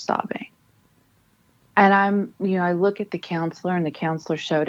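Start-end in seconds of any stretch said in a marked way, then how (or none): none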